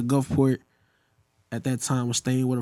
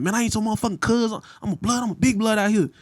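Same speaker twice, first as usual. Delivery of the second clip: imitating an angry man, pitched up; retold mad; 6 semitones higher than usual. Gang member correcting a teen, mad. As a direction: puff up and protest, retelling his anger. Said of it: "like, he got mad".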